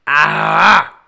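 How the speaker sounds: loud wordless yell of frustration; frustrated yell. Real, not acted. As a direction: let out a loud exasperated "aah". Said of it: "That's how I feel".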